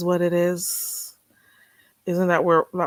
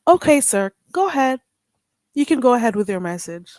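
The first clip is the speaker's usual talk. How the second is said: polished phone receptionist; 6 dB louder than usual. Acted. Fake receptionist greeting a caller, formal and loud. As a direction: play a formal receptionist taking a call.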